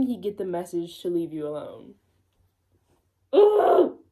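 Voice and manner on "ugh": a loud, high groan of disgust; cringing ugh at the fanfic. Real, not acted. Reader groans at the story.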